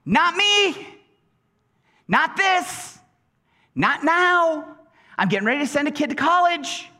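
high, urgent mock protest; acted high-pitched desperate prayer; crying out. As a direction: plead upward in pitch, as if panicking. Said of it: "and we cry out".

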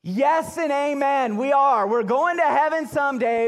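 put-on half-joking yell at the kids; playful shouted agreement; mock yell. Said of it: "I'm going to yell at you".

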